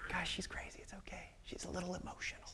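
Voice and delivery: whispered aside, patronizing; condescending whisper. Acted. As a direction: murmur behind her back. Men muttering about Mary.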